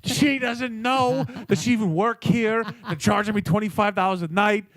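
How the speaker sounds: mock rant, pitched high; loud retold grumble meant to be overheard; loud complaining. Imitating a diner as the waitress walks away, loud complaining. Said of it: "he's just loudly just like".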